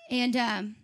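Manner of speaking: ordinary talk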